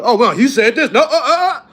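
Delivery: loud, high mock nitpicker with a laugh; mocking critics. Mocking his critics, loud laughing gotcha voice. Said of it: "folks are trying to hit one".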